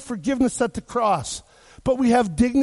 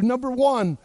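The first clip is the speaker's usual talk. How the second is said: ordinary talk, nothing marked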